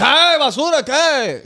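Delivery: high-pitched, hammed-up insult; mock angry outburst. Tipsy driver insults the officer, mock angry outburst.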